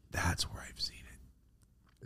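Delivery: unvoiced hushed realization; whispered discovery. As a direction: breathe it out as it dawns on you. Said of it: "I went".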